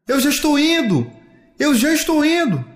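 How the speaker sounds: high, insistent answering call; child's high-pitched reply; calling back. Acted. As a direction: holler that you are coming. Child calls back, following.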